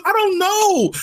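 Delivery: high frustrated outburst; exasperated yell. Real, not acted. Ranting about Star Wars lore.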